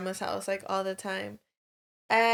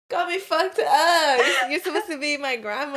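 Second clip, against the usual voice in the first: mock indignation; 3 semitones higher, 4 dB louder than usual. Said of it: "I was like".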